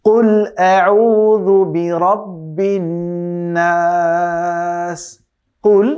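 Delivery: very slow chanted verse, long held নাস; Quran recitation. Shown as a demonstration. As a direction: recite slowly, stretching the last word. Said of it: "খেয়াল করে শুনতে হবে". (দীর্ঘ টেনে সুরেলা তিলাওয়াত)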